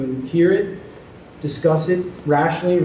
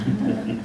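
short laugh; 7 semitones higher, 4 dB quieter than usual. Real, not acted. Joking about being hired again.

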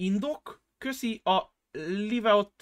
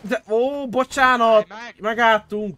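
high, loud protesting apology; urgent pleading; 6 semitones higher, 7 dB louder, slower than usual. Real, not acted. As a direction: call out a desperate surrender. Pleading with those shooting at them.

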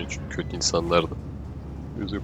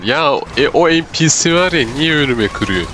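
outraged; 7 semitones higher, 6 dB louder than usual. Real, not acted. NPC cuts him off.

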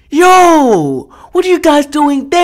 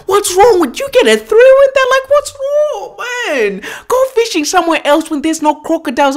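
high, climbing, incredulous complaint; exasperated scolding; 6 semitones higher than usual. Real, not acted. Viewer asking thrill-seeking anglers what is wrong, exasperated. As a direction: push the voice way up in exasperation.

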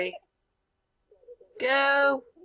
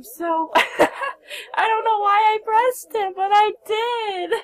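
much higher and louder, slow, through laughter; laughing; 10 semitones higher, 6 dB louder, slower than usual. Real, not acted. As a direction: giggle through the confession. Laughing at own choice.

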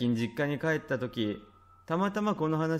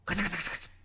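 voiced clattering doorknob noise, higher; door handle rattling; 5 semitones higher, 4 dB quieter, much faster than usual. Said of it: "音が聞こえてくるんだ".

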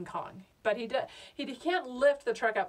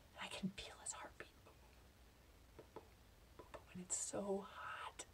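awed whisper; 20 dB quieter than usual. Acted. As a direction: marvel at it almost silently. Hushed awe beside sleeping gorilla.

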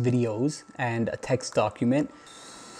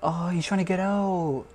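higher, slow, anguished; slow, higher, hurting for the squirrel; pained pity; 6 semitones higher, slower than usual. Real, not acted. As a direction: ache for the trapped animal. Watching trapped squirrel struggle.

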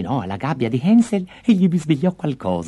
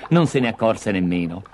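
ordinary delivery

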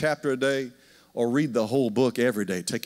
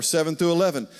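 ordinary delivery

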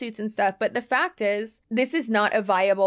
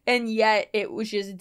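ordinary delivery

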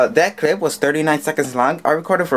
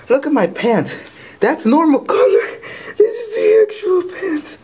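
excited. Real, not acted. Showing soaked pants, pitch jumps.

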